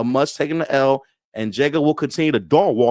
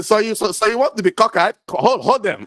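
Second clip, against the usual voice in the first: imitating stern dad; 8 semitones higher than usual. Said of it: "He saw me to".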